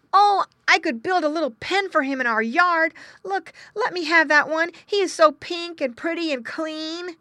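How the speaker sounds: put-on high, loud, eager child voice; pleading boy. Boy persuading father, pleading. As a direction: argue eagerly like a small boy.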